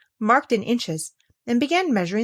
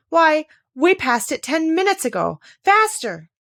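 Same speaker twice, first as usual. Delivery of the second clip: urgent call; 8 semitones higher, 5 dB louder than usual. Acted. Queen urging while running.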